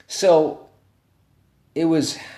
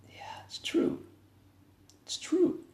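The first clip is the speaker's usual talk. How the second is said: soft whisper, much softer; parents whispering; 9 dB quieter, slower than usual. Retold or imitated. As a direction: whisper it, moved to tears. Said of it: "they're crying".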